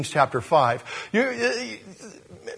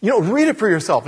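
ordinary delivery

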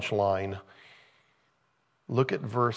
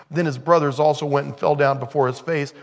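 ordinary talk, nothing marked

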